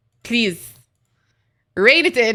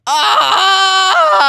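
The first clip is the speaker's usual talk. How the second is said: loud high squeal; squealing cry; 10 semitones higher, 8 dB louder than usual. Real, not acted. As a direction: shriek at the cat's cuddles. Cat cries, she shouts.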